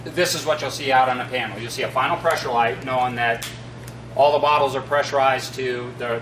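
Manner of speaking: ordinary talk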